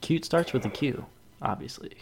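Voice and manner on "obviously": breathy whisper on obviously; whispered aside. Real, not acted. Cohost tacking on a whispered obviously about Q. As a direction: drop it to a sly whisper.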